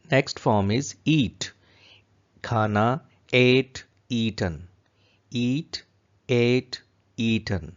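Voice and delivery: ordinary talk, nothing marked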